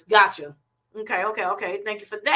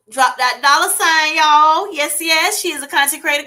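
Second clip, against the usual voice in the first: excited hype; 6 semitones higher, 7 dB louder than usual. Real, not acted. Host plugging a viewer's channel, excited. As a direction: hype her up to the crowd, pitched up and loud. Hyping a fellow creator to viewers.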